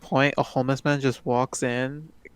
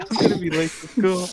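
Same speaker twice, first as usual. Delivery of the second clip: mock worry; 4 semitones higher than usual. Retold worried thought.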